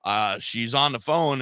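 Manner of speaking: ordinary delivery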